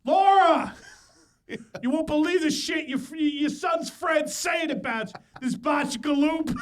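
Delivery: mock tattling dad, high and loud; imitation of dad. Son acting dad tattling to mom, hammed-up holler. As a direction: holler for Laura, hammed-up outrage. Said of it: "my dad just runs and tells her".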